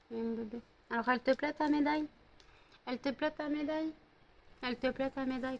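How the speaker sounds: high, soft cooing. Real, not acted. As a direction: coo softly at the dog. Baby talk to dog.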